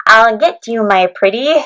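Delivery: loud, nasal storybook witch; hammed-up nasal witch threat; nasally witch voice. Shown as a demonstration. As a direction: demonstrate a witch through the nose. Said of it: "witches always kind of have this".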